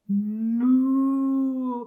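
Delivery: long drawn moo; puppy mooing instead of barking; cow moo. A narrator's reading. Puppy moos.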